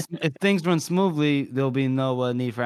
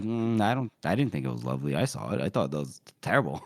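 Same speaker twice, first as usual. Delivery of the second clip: much lower, quiet disapproval; deep mock solemn; 10 semitones lower, 5 dB quieter, faster than usual. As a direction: rumble it out grimly. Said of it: "Dogman's like".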